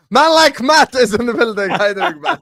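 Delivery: high, loud announcer welcome; loud hype-man welcome of a guest; hyped introduction. Acted. Hyping in the next guest.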